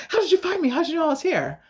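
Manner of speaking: high, panicky mock question; mock-frightened squeak; imitating frightened man. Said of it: "like, frightened".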